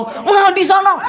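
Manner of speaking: a sudden high mock yell at the child; mock angry outburst. Shown as a demonstration. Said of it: "Tapi kalau langsung". (ibu membentak anak dengan marah pura-pura, suara tinggi)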